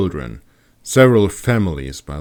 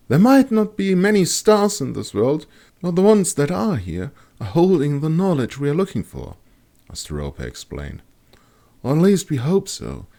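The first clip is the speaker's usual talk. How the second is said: Asteropa's voice; 10 semitones higher than usual. A narrator's reading. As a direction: explain it patiently, an astrologer at ease.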